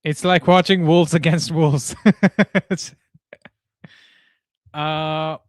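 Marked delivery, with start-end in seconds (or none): 2.0-2.6 short loud burst of laughter; real laugh bursting out. Real, not acted. Laughing at own joke.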